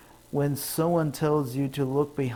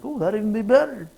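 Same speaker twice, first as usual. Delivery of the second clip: quick, higher pleased reply; delighted; 8 semitones higher, 6 dB louder, faster than usual. Retold or imitated. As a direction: jump on the offer gleefully.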